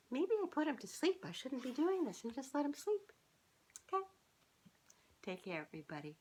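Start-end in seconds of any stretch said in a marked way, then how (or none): 0.1-4.0 pet talk. Real, not acted. Talking about sleepy pet.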